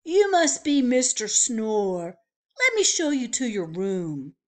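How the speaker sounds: loud, high, cheery bellhop welcome; chirpy old-fashioned bellhop. Acted. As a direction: call out the welcome like a grand hotel porter. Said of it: "said the bellhop".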